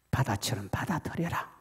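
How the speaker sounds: quiet, low-spoken instruction; soft command. Acted. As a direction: say it gently, like a command from above. God's command voiced softly. (조용하고 낮은 명령)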